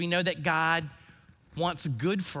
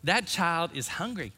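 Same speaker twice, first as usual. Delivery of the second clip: lightly imitated, higher-pitched Mississippi grandmother; Mississippi accent; 5 semitones higher than usual. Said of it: "in her beautiful Mississippi accent".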